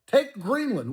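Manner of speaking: ordinary talk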